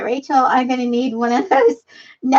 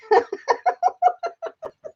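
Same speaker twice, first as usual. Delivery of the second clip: very high, squeaky laughing fit; helpless laughter; 13 semitones higher, 4 dB quieter than usual. Real, not acted. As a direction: dissolve into long high giggles.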